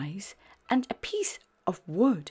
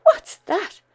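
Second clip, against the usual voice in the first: high, loud startled ask; startled by something unseen; surprised question; 12 dB louder than usual. A narrator's reading. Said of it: "Spot asked".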